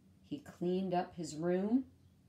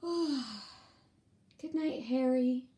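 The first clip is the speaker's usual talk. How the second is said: higher, sweeter toy voice; sweet high goodnight; 6 semitones higher, much slower than usual. Acted. Lula wishes Harry good night in a high sweet voice.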